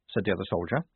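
ordinary delivery

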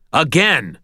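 forceful drill command; insistent command. A narrator's reading. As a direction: demand a repeat. Drilling Butters to repeat it.

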